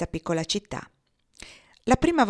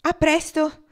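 high, louder parting call; calling goodbye; 10 semitones higher, 6 dB louder, slower than usual. A narrator's reading. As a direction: call it out across the bar. Farewell called out together. (saluto chiamato, più alto e forte)

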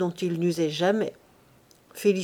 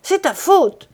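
loud, much higher cry; last-strength shout; 8 semitones higher, 8 dB louder than usual. A narrator's reading. Pierre screaming at his wife, final outburst. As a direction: hurl the blame with all he has left. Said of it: "cria Pierre en mettant dans ce cri ses dernières forces".

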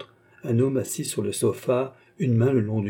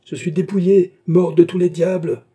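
loud, high outburst of rage; high, heated bellow; furious yell; 7 semitones higher, 8 dB louder than usual. A narrator's reading. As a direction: yell it out like a curse. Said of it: "hurla Beausire".

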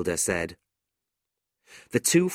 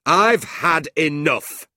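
loud, slow, raised-pitch outburst; angry shout; 8 semitones higher, 7 dB louder, slower than usual. A narrator's reading. John banging the table at the quarrel, fed-up shout. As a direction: bellow it, each word drawn out. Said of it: "he shouted".

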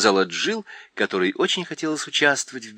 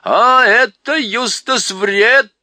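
much higher, much louder slow shout; owl yelling with all her might; 12 semitones higher, 9 dB louder, much slower than usual. A narrator's reading. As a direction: bellow it at full strength. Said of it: "изо всех сил прокричала сова".

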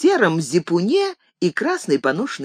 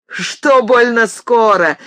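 higher, louder, drawn-out jeer; насмешливый перехват; 5 semitones higher, 5 dB louder, slower than usual. A narrator's reading. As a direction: cut in scoffing at a rival. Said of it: "подхватил рослый извозчик". (громко, насмешливо перебивая)

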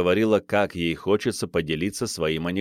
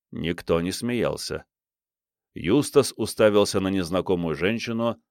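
usual speaking voice

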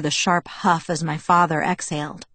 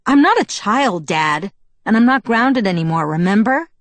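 raised, indignant comeback; angry retort; 4 semitones higher, 5 dB louder than usual. A narrator's reading. Daughter answers her father's tone, angry retort. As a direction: fire back at a parent, voice up. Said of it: "Anger pulsed through me automatically".

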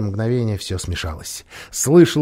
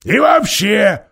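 raised voice; 9 semitones higher, 6 dB louder, slower than usual. A narrator's reading. Nikabrik presses his argument.

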